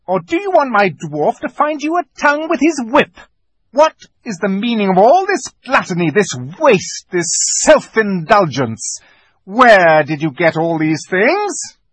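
cold furious queen, slowing at the end; menacing witch queen. Acted. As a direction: loom over the feast and threaten. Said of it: "she said again".

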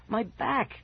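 high, helpless wail; helpless cry. A narrator's reading. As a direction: cry out for help, pitched high. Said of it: "I cried helplessly".

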